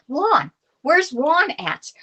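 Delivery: drawn-out shout of a name; calling out. Real, not acted. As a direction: call out for a friend who's gone missing. Calling out for missing friend.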